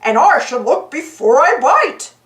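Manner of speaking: lightly voiced goofy dog. A narrator's reading. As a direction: say it like a dopey good-natured dog. Goofy voice continues.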